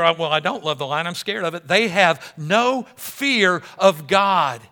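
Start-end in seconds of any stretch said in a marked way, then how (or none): 1.7-4.6 very slow, loud, weighty delivery; slow emphatic. Real, not acted. Preacher slowly stressing the feared line. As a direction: lean hard on every word. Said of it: "I love the line here".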